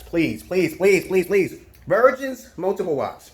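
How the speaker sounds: hammed-up plea to the audience; mock pleading. Begging viewers, rising in volume.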